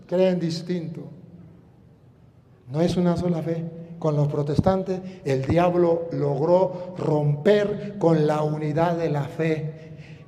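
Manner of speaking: usual speaking voice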